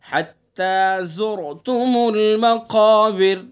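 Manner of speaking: very slow, drawn-out verse with a stop on المقابر; Quranic recitation. Shown as a demonstration. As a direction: recite and pause on the final را. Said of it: "را بتائیے کیسی ہوگی مقابر کی؟".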